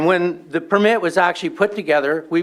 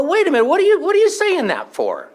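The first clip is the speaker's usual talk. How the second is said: shocked retort; 9 semitones higher than usual. Retold or imitated. Confronting insulting stranger.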